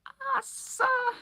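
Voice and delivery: very high, loud gasping cry; tearful elf gasp, very high. Acted. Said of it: "he gasped".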